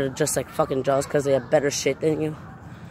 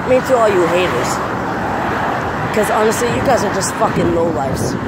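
furious yelling rant; 4 semitones higher than usual. Real, not acted. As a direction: shout them down in real anger. Ranting furiously at online haters.

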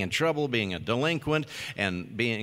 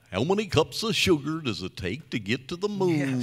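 higher-pitched cartoon goof voice; Goofy; 5 semitones higher than usual. Voice actor re-performing his film line for fans, Goofy voice. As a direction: ask it as a lovable cartoon goofball. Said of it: "it not sounding like Goofy".

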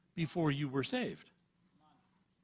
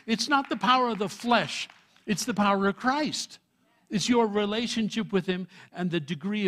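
full-force preaching; 4 semitones higher, 5 dB louder than usual. Acted. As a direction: thunder the verse out to the congregation. Preaching climax.

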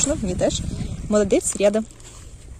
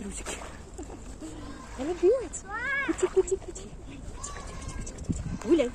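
talking to a dog; 6 semitones higher, 11 dB quieter than usual. Real, not acted. Training dog with treats.

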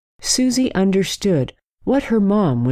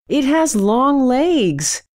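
put-on child's yell; 11 semitones higher, slower than usual. A narrator's reading. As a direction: stretch it out like an upset little girl. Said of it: "yelled Susie".

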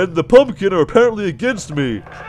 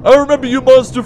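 ordinary delivery

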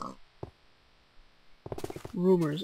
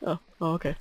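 normal talking voice

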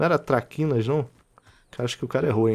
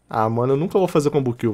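usual speaking voice

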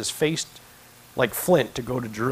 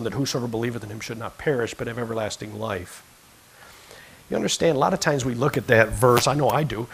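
ordinary talk

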